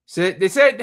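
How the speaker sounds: ordinary talk, nothing marked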